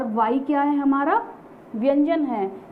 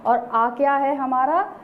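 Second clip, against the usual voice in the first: ordinary talk, nothing marked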